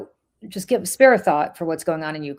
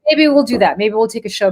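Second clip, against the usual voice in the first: excited; 6 semitones higher, 10 dB louder than usual. Real, not acted. Excited reply to protest idea.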